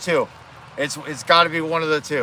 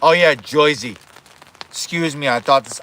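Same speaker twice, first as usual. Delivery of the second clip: usual speaking voice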